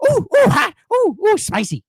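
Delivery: very high yelps of a man on fire; burning stuntman. Retold or imitated. Co-host mimics the burning stuntman, high silly yelps. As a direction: squeal ooh hot like you're burning. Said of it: "He's like".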